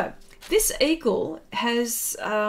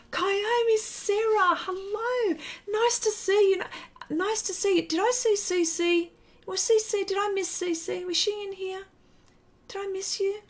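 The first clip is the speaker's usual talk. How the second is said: high sweet greeting; 9 semitones higher than usual. Real, not acted. Greeting chat viewers.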